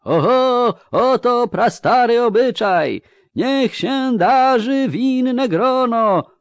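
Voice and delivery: high, loud, drawn-out herald's chant; ringing, slow master-of-ceremonies cry; ceremonial proclamation. A narrator's reading. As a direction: shout the ancient rite out to the crowd. Chamberlain proclaims ritual to crowd.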